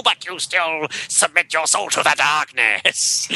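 high, ringing epic metal narration; heavy metal voice. Acted. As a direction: chant it like a battle hymn. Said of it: "heavy metal screechy voice".

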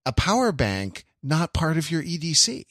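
normal talking voice

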